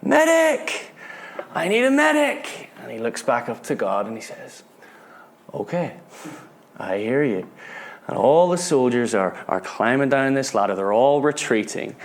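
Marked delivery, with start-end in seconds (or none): 0.0-2.7 high-pitched cry for help, held back in volume; distant shout. Retold or imitated. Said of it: "this soldier shouting". 5.5-7.5 soft, resolved answer to God; hushed acceptance. Retold or imitated. Soldier answers God's call.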